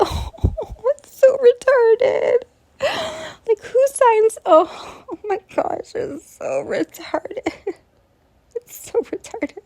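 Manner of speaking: high, breathless laughing words; laughing too hard to talk; words squeezed out through laughter; hysterical laughter. Real, not acted.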